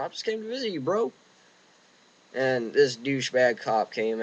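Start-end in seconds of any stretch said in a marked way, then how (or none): 0.0-1.1 meek reply. Retold or imitated. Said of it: "I'm sitting there going".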